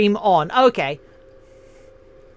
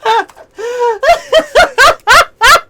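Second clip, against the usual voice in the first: shrieking laugh, very high; high squealing laugh; 12 semitones higher, 9 dB louder than usual. Real, not acted. Laughing at chat.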